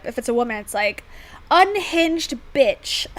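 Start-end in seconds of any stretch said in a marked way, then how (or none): 1.5-3.1 higher, drawn-out mock judgement; mock disdain. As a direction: draw out the mock insult. Voices a double-standard judgement of women.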